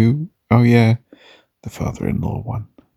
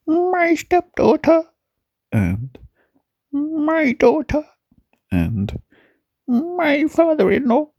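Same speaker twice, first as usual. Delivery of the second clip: high voice; slower than usual. Pretend. Listing family in a put-on voice.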